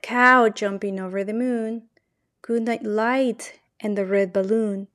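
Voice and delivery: plain reading voice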